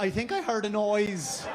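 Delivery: usual speaking voice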